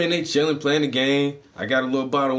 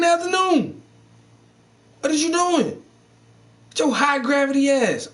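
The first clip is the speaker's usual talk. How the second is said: incredulous scolding; 11 semitones higher than usual. Retold or imitated. Scolding a daytime street drinker.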